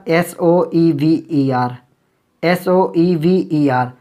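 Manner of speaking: एस ओ ई वी ई आर spelled letter by letter, very slowly; spelling out letters. Shown as a demonstration. Teacher spelling "soever" letter by letter for the class. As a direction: spell एस ओ ई वी ई आर one letter at a time. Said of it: "so ever".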